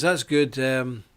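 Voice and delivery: usual speaking voice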